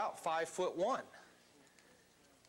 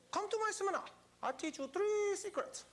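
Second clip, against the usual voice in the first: Tom Vu impression. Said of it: "Remember how he used to say that".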